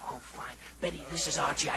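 low, soft, hoarse phone voice; laryngitis. Acted. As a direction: struggle to get through with no voice. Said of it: "he has laryngitis".

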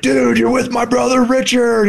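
high-pitched, excited shouted quote; all fired up. Retold or imitated. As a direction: imitate an excited friend calling out. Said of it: "He's all fired up".

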